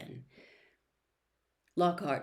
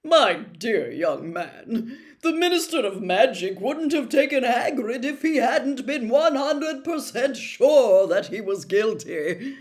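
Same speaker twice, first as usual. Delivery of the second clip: patronizing; 5 semitones higher, 10 dB louder than usual. A narrator's reading. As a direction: explain the obvious to a child. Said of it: "in the tone of someone explaining that one and one made two".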